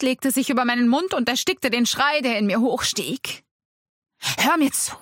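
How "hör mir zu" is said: urgent, higher-pitched hiss; hissed urgently. A narrator's reading. Man restraining a screaming woman, urgent hiss. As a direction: hiss it to make her listen. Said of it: "zischte Attis".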